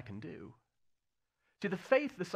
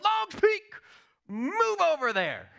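much higher, loud, slow mock command to a mountain; commanding shout; 9 dB louder, slower than usual. Teen believer commanding the mountain: high loud mock shout. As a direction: order the mountain to move with total faith, hammed up. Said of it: "with all the faith in the world".

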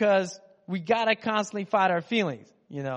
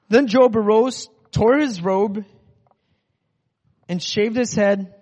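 louder, slower, solemn build; dramatic reading; 4 semitones higher, 8 dB louder, slower than usual. Acted. Preacher reading Job's grief, loud and slow. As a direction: read it like a big dramatic moment. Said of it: "dramatic scripture reading".

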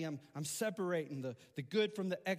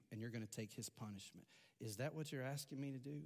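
gentle questioning; 4 semitones lower, 6 dB quieter than usual. Retold or imitated. Teacher gently checks the boy's request.

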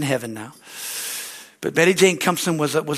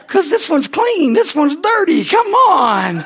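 high, loud mock exasperation; pleading, hammed-up frustration; frustrated protest; 11 semitones higher, 7 dB louder, slower than usual. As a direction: complain loudly like a fed-up pastor. Acts out frustration with God.

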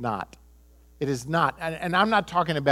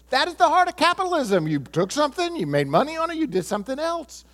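higher, fast, pressing the point; emphatic retort; 9 semitones higher, faster than usual. Retold or imitated. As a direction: drive the point home to a friend. Retold emphatic reply to friend.

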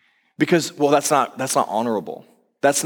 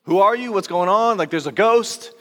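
mock panic; 7 semitones higher than usual. Imagined panic at sudden visitor.

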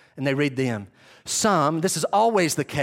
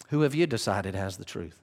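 ordinary talk, nothing marked

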